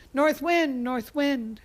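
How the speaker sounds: high, loud call, easing slightly; yelling. Acted. Boy addressing the North Wind, yelling. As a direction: holler to get the wind's attention. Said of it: "he started yelling".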